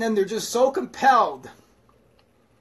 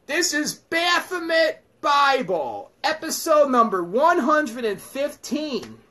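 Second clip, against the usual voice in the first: slow, loud, high booming announcer shout; show announcer; 9 semitones higher, 6 dB louder, slower than usual. Acted. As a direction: boom out the show's title.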